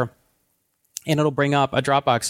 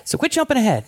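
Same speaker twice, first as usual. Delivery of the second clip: playful scolding; 8 semitones higher than usual. Real, not acted. Mock scolding of interrupting co-host.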